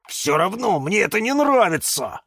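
high, huffy protest; huffing. Acted. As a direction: puff out your displeasure. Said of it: "пропыхтел кактус Кирилл". (обиженно пыхтя)

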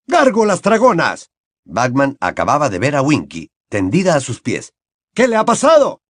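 high, loud hearty questioning; bluff Bagman. Acted. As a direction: Bagman quizzing Barty Crouch, hearty.

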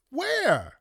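shrill disbelieving "Where?"; high, stunned single-word question; incredulous. Real, not acted. Incredulous at clip's claim.

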